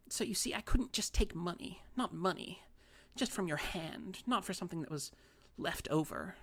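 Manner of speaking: put-on a wronged old lady sulking; fussy little old lady. A narrator's reading.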